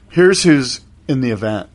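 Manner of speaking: ordinary delivery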